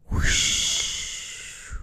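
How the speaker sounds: soft breathy whoosh of wind. A narrator's reading. Narrator makes the wind blow the sheet, airy whoosh.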